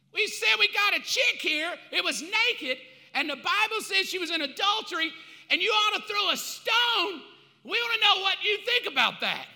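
loud, high demanding yell; high mob yell for a stoning; angry mob shout. Acted. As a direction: shout the challenge at him.

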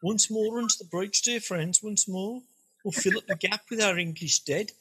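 rally the troops in a Brummie accent; Brummie Shakespeare. Shown as a demonstration. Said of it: "in a Brummie accent".

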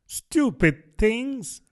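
slow, high indignant outburst; a girl's indignant cry; loud, indignant. Acted. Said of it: "in a loud, indignant voice".

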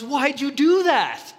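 high, slow outraged question; dismayed Frog. Acted. Frog protesting to Toad about the cookies, high slow dismay. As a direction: let Frog wail in dismay.

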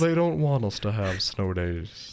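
mope through it; sad voice. Pretend. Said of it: "let me put on my sad voice again".

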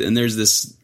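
usual speaking voice

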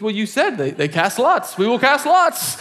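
proud, almost giggling, as if it's obvious; James, laughing certainty. Retold or imitated. James explains to the pastor they'll cast lots, laughing. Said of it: "He goes".

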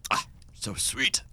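a slow whispered sigh of delight; breathy sigh. Acted. Huber sighing over the cookie, slow and whispered. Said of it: "and he just does, like, a".

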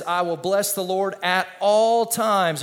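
scripture reading. Acted. Preacher reads the verse aloud.

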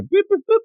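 high, chirpy boops; keypad beeps. Retold or imitated.

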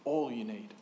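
low, soft and certain; voice of God. Acted. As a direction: say it as a quiet promise. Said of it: "he says this".

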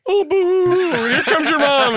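high, loud mocking singsong; mocking taunt. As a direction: taunt him like a bratty kid. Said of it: "And he's like".

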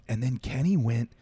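usual speaking voice

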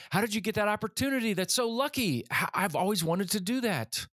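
amazed classmates. Retold or imitated. Classmates hearing about the shepherd opportunity, amazed and envious. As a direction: gush like impressed classmates, pitch well up. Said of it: "my classmates asked again".

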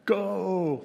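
put-on high, bossy little-kid shout of Go; toddler ordering. Little girl pointing a visitor out, high and bossy. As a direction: boss them out like a pointing toddler. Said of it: "points and says".

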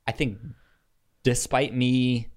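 ordinary delivery

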